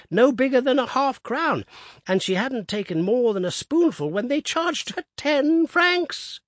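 put-on high, loud indignant old man; old Woodifield outraged at hotel prices; indignant piping. A narrator's reading. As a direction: huff over the price of jam. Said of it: "he piped".

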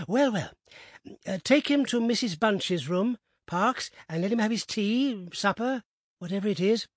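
lightly voiced eager host; eager old gentleman. A narrator's reading. Abney dismisses to servant.

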